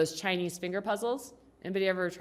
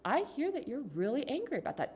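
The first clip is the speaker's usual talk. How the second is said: higher, softer, patient reply; calm validating; 5 semitones higher, 5 dB quieter than usual. Acted. Parent to pissed-off teenage daughter, calmly validating. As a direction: answer the outburst gently and evenly.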